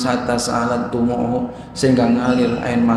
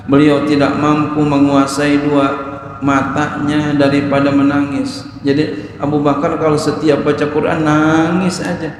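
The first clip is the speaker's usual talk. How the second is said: ordinary talk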